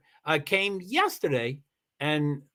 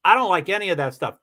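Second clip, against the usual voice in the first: ordinary talk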